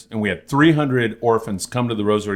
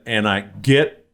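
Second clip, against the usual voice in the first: usual speaking voice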